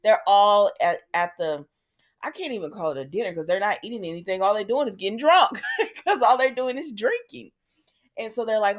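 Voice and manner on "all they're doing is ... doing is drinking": real laugh breaking through, higher pitch; amused laughing. Real, not acted. Host mocking a dinner that's only drinking, laughing. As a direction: laugh while pointing out the absurdity.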